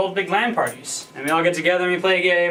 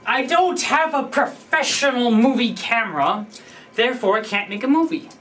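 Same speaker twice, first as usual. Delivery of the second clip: whiny excuse; 7 semitones higher than usual. Retold or imitated. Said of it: "You say".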